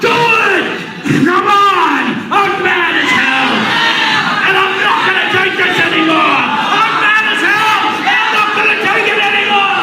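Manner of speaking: high full-throated yell; yelling at the top of voice. Acted. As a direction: shout it at the top of your lungs. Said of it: "yell at the top of your voice".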